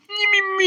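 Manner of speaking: high squeaky little kisses; mock puppy kiss. Imitating his little dog giving kisses, high squeak. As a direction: squeak it like a tiny dog licking.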